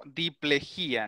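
diplejía slowed, stress on jí: di-ple-JÍ-a; slow corrective demo, diplejía with a stressed í. Shown as a demonstration. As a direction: draw out diplejía and lean on the í. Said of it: "yo le pongo acento ahí".

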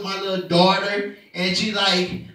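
ordinary delivery

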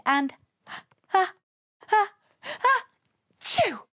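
building, high gasps into achoo; stagey sneeze with a long build; acted sneeze. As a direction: wind up to a big mock sneeze. Narrator acts out Pippa's sneeze.

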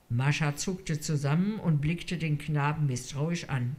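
ordinary delivery

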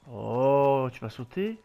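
normal talking voice

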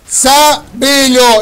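slow, loud, stretched out; drawn-out hype catchphrase. Acted. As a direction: belt it out, slow and high. Hyped gamer catchphrase.

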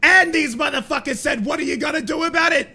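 loud, high, outraged rant; angry shout. Real, not acted. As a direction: shout it out in disbelief. Furious rant about the taunt.

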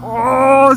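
sudden loud exclamation as the bike dips; startled cry. Real, not acted. Rider nearly scrapes the foot board, startled cry.

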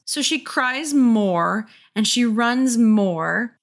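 slow mock-dramatic narration. A narrator's reading. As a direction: drag it out for drama.

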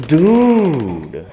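loud, high sudden yell of outrage; outraged shout. Real, not acted. Gamer blurting at his game death, a sudden outraged shout. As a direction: explode at the screen.

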